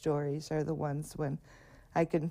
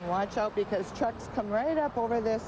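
called-out warning; 5 semitones higher than usual. Real, not acted. Warning kids at crossing.

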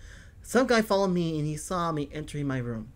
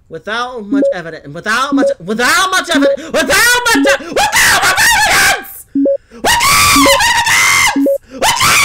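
building from loud to piercing shriek; escalating scream; 13 semitones higher, 14 dB louder than usual. Acted. Frustrated at police needing evidence, building to a scream. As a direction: rise into a frantic scream.

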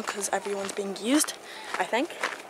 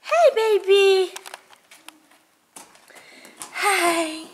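cooing pet talk; 10 semitones higher, 6 dB louder, much slower than usual. Real, not acted. Rider greeting the horse Jack, high cooing pet voice. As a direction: coo a long, high hello to an animal.

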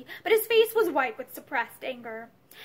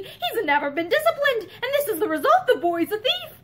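higher-pitched angry accusation; 7 semitones higher, 4 dB louder than usual. A narrator's reading. Husband denouncing the child to his wife, angrily. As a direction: condemn the boy in a raised, angry voice. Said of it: "he said angrily".

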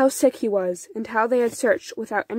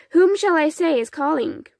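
put-on young boy; 7 semitones higher, 4 dB louder than usual. A narrator's reading. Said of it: "answered Pavel".

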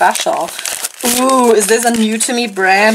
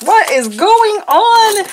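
startled; 11 semitones higher, slower than usual. Retold or imitated. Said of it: "and I was like".

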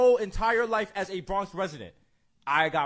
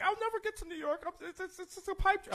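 put-on a much higher, softer whiny girl voice; mock crying girl; 14 semitones higher, 8 dB quieter than usual. Mimicking a club girl crying about New York, high and whiny. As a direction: squeak it out like a sobbing girl who dreams of New York.

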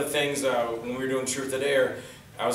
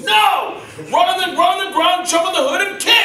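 very high, loud exasperated shout; imitated yelling; 9 dB louder than usual. Stunt coordinator after another failed kick, mock yelling. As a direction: scream the orders as an exasperated coordinator. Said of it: "he's yelling at me".

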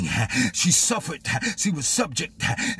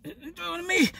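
high, small puzzled voice; timid surprise; 10 semitones higher, 4 dB quieter than usual. Acted. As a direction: ask it high, unsure it's for you. Hunchbacked woman, surprised at call.

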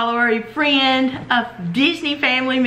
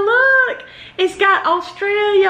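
excited look; 9 semitones higher than usual. Real, not acted. Pointing out koala's bandana.

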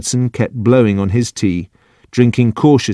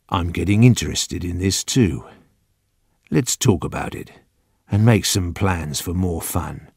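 low, soft coaxing; very gentle; 3 semitones lower, 5 dB quieter than usual. A narrator's reading. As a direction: draw him in gently, like a confidant. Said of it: "The old man was speaking very gently".

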